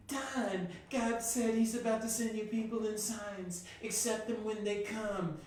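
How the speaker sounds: high-pitched, drawn-out proclamation; mock messenger. Friend passing on God's message to him, imitated high proclamation. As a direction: imitate someone announcing a message, pitched well up.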